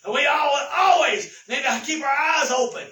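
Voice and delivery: fiery revival preaching; full-force preaching. Acted. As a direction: drive the point home to the church.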